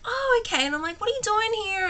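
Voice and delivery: high chirpy surprise. Retold or imitated. Narrator chirping back at the bald guy, high surprised. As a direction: bounce it up, brightly surprised.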